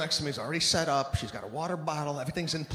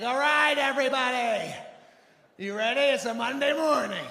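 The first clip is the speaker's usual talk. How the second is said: high, loud, peppy spin-teacher hype; overly happy instructor; 7 semitones higher, 3 dB louder than usual. Retold or imitated. Spin instructor firing up the Monday class, peppy hype. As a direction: pump up the room like a too-cheery coach. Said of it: "way too happy".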